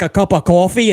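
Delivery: ordinary talk, nothing marked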